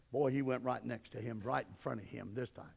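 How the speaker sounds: normal talking voice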